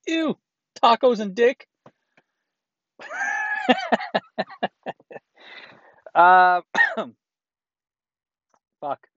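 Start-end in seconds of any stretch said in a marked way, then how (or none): 0.1-1.7 high squeaky ew, then louder; mock disgust. Playing the boyfriend tasting her breath, mock disgusted. 3.0-5.2 high shrieking laugh; burst of laughter. Real, not acted. Host cracking up at his own joke, shrieking laugh.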